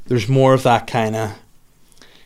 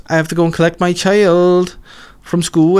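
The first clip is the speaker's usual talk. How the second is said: slipping into a Welsh accent, pitched up and louder; a sudden Welsh lilt on the sentence end; accidental Welsh accent; 7 semitones higher, 4 dB louder than usual. Real, not acted. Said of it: "don't know why I went Welsh there".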